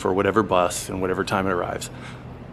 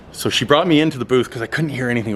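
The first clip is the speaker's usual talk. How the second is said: usual speaking voice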